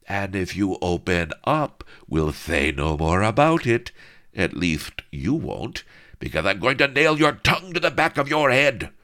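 threaten, then explode; hammed-up brute's threat; menacing threat. Lardo threatening.

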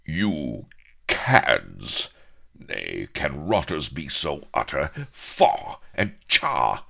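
loud, spluttering indignation; outraged hero denouncing cads; disgusted. A narrator's reading. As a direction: recoil in theatrical disgust. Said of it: "Avery quivered with disgust".

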